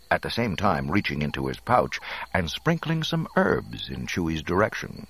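ordinary delivery

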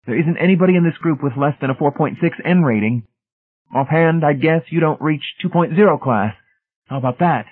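high, insistent technician voice; pitched well up, showing off superiority; zealous Brady, challenging. A narrator's reading. Brady belittling Hamilton's rating, challenging.

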